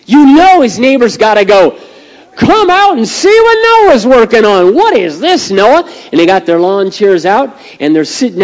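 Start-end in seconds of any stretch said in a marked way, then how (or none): 2.3-5.9 high, loud hollering neighbor voice; hammed-up neighborly shout; gawking neighbor. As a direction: call everyone over to gawk. Neighbors mocking Noah in story.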